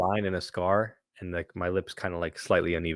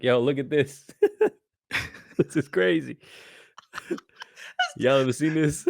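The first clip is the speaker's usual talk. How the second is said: high, amused boasting through laughter; pretend showing off; 6 semitones higher than usual. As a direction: show it off to everyone, cracking up. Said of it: "I would've just been like".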